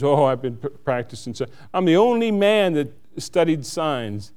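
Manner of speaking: ordinary delivery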